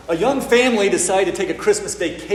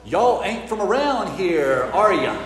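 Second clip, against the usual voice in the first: put-on Southern accent; 4 semitones higher than usual. Southern local questions visiting family outside church, Southern accent. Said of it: "He said in his lovely southern voice".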